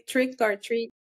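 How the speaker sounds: trick or treat, slowly, in Québécois English; Québécois English accent. Shown as a demonstration. Guest correcting the attempted translation, Québécois English accent. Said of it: "ça passe mieux avec l'accent anglais québécois".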